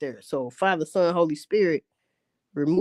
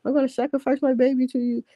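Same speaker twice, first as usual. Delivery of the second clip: high, quick put-on pleading voice; mock guilty monk; 7 semitones higher, faster than usual. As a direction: beg God like a guilty monk.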